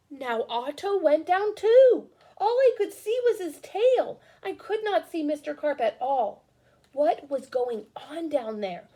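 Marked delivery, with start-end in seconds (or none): none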